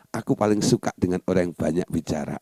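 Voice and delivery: low, soft, measured declaration; subdued accepting tone; calm affirmation. Shown as a demonstration. Models the positive statement after the complaint.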